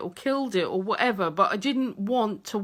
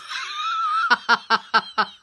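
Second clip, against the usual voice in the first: a long squealing laugh, much higher. Real, not acted. Wife picturing husband's colourful boxers, squealing laugh. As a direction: let out a high helpless squeal of laughter.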